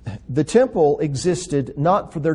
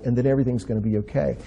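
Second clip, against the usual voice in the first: ordinary talk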